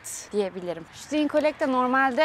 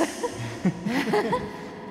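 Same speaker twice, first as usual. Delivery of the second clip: laughing; 3 semitones higher than usual. Real, not acted. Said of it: "sallanıyoruz".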